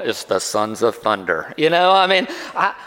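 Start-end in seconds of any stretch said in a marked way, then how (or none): 0.0-1.5 low, slow teasing mutter; mocking disciples. Disciples teasing James and John, low and slow. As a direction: rib the brothers with a deep, slow drawl. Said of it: "other disciples were going".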